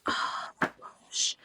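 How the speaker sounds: slow breathless whisper; awed whisper. Real, not acted. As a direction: sigh it out in wonder. Gushing over books on shelf.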